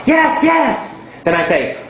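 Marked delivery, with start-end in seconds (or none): 0.1-0.8 high excited shouted yes; eager shout. Retold or imitated. Student shouting the answer to the teacher, high and excited. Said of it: "And you shout".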